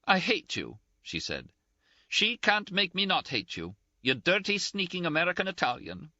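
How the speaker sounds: high-pitched, indignant, close to tears; Ferguson's scolding. A narrator's reading. Ferguson voiced, tearful anger.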